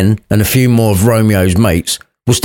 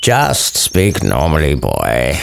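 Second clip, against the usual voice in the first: gruff friar; 6 semitones lower, slower than usual. Acted. Friar voiced by narrator.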